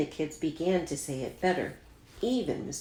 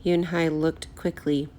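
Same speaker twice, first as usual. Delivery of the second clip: usual speaking voice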